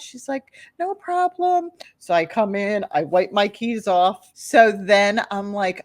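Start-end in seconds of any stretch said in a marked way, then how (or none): 0.8-1.7 high-pitched breezy reply; breezy mom. Retold or imitated. Mom replies to thanks, breezy. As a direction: toss it off in a high voice.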